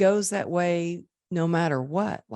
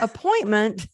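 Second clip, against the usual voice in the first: high mock self-quote; 5 semitones higher, 4 dB louder than usual. Retelling her call to client.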